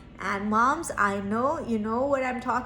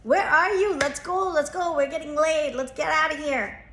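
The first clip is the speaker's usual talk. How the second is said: calling loudly through the house, pitched up; 5 semitones higher, 5 dB louder than usual. Retold or imitated. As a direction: hurry a kid out the door from another room. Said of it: "And I go".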